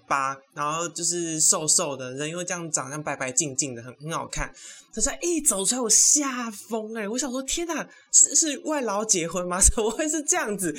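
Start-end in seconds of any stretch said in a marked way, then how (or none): 4.9-10.7 high-pitched comic disbelief; freaked-out shock. Retold or imitated. Telling how the groom walked out, shocked high outburst.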